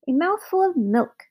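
high little magic man; clear, thin voice. A narrator's reading. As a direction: call up in a thin, clear pipe. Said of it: "said the clear, thin voice from below".

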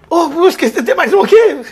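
high, loud call down the hall. Retold or imitated. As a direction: shout to ask if anyone is left.

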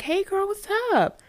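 high, bright sing-song hello; cheery phone greeting. Retold or imitated. Answering her friend's call, high and cheery.

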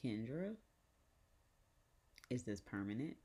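measured, halting question with long pause; imitated grandmother. Grandmother asking Kendra about the face, restrained imitation. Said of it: "So she's like".

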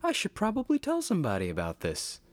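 high-pitched, fast dawning idea; mock sudden realization. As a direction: play a naive epiphany, hammed up. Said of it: "born with just an innate radical idea out of nowhere that".

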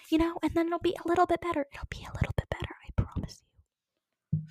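fast, breathy whispered reassurance; mock whisper. Mock-soothing the Twitter commenter in a fast whisper.

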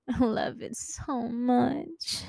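high, soft, slow squeal of affection; gushing. Pretend. Telling listeners she loves having a boyfriend, gushing softly. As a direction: squeeze it out high, small and drawn out.

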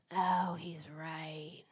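slow, hushed whisper as it sinks in; whispered realisation. Retold or imitated. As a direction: breathe it out very slowly, acting out the dawning admission. Retold stunned realisation that he's right.

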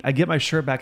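ordinary talk, nothing marked

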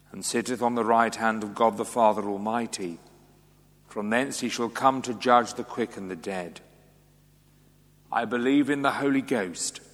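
ordinary talk, nothing marked

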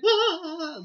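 high, loud mock scream; hammed-up shriek of fright; panicked wail. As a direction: let out a comic panicked cry. Acting out panicked noise.

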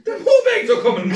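high, loud laughing shout; squealed mock panic; mock alarm. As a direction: yell it like a hammed-up warning. Joins in the acted alarm.

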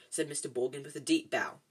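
plain reading voice

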